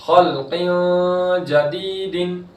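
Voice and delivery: slow, drawn-out Quranic chanting; tajweed recitation. Shown as a demonstration. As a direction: stretch the vowels in recitation. Recites example.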